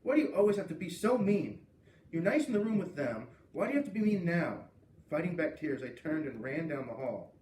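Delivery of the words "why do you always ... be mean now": higher and louder, accusing; angry confrontation. A narrator's reading. Girl yelling at a bully, angry. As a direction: confront her, upset and hurt. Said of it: "I exploded".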